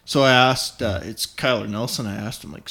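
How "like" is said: ordinary talk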